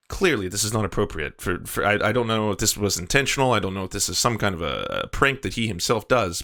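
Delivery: ordinary talk, nothing marked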